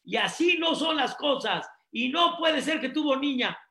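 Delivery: mock father yelling in anger; hammed-up furious father; shouting. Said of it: "empezó a gritar".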